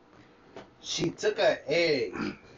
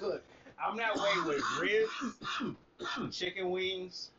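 ordinary talk

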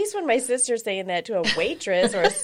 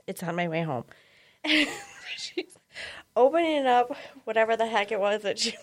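ordinary talk

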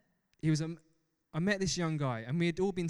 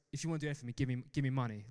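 normal talking voice

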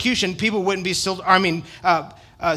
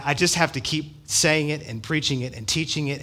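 ordinary talk

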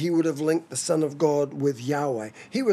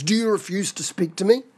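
raised-pitch exasperated appeal; frustrated; 3 semitones higher than usual. Acted. Pilate to a silent Jesus who refuses to speak, frustrated. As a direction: plead in mounting frustration, pushing the key words. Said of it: "moved with frustration".

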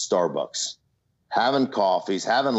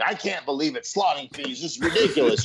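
higher, parroting his partner's complaint; mimicking partner; 5 semitones higher than usual. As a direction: echo him like a little mynah bird. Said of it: "I'm like a little minor bird".

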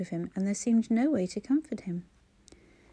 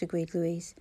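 plain reading voice

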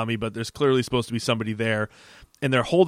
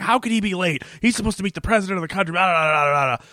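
higher, rushed mock panic; imitated freak-out; 7 semitones higher, faster than usual. Officials fretting over Pete being late, mock freaking out. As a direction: act out someone flustered, tumbling into blah-blahs. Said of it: "freaking out 'cause Pete's late".

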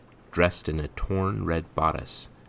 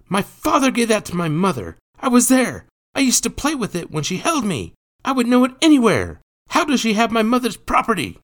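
very high, loud pleading outrage; defiant, insistent boy; 10 dB louder, faster than usual. A narrator's reading. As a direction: argue back as a child, high and loud. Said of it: "Jacques would not be deterred".

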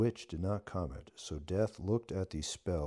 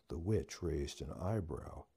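plain reading voice

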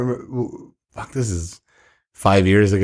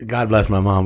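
ordinary delivery

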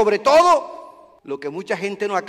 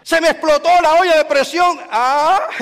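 higher, louder mock lament; mock complaint; 8 semitones higher, 8 dB louder than usual. Preacher imitating a believer's petty misfortune. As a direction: ham up the mishap.

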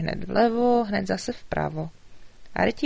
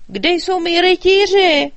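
loud, slow demanding shout, higher; angry king; 10 semitones higher, 12 dB louder, slower than usual. A narrator's reading. King storming out and shouting for his knights.

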